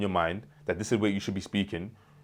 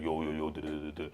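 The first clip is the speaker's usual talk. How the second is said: mock forced deep voice, much lower and softer; 5 semitones lower, 11 dB quieter than usual. Vlogger mocking his younger self forcing deep voice. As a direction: push the voice down into an exaggerated fake bass. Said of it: "I used to force and be like".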